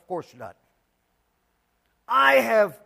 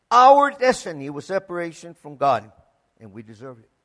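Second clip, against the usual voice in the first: normal talking voice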